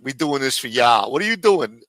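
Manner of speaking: ordinary talk, nothing marked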